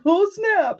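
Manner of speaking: high, startled exclamation; surprised. Retold or imitated. Neighbors realizing their horse escaped, high startled surprise. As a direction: jump up in pitch in alarm. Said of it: "they're like".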